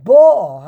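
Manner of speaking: BO'a, leaning hard on the opening syllable; stress on first syllable. Shown as a demonstration. Teacher quoting the commentary, first syllable stressed in BO'a. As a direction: punch the BO in bo'a, then drop. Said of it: "the emphasis is on the first syllable".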